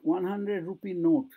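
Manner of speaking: normal talking voice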